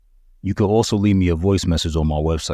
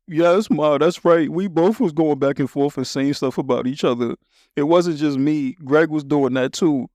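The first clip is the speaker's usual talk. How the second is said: put-on a boy owning up to mom; kid answer; 7 semitones higher than usual. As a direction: younger son answering his mother, higher-pitched. Boy answers his mother.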